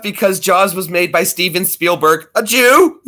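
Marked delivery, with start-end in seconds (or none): 2.3-3.1 high, loud burst breaking into laughter; laughing. Real, not acted. Streamer delivering a punchline to chat, laughing. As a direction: crack up as you say it. Cracking up at own joke.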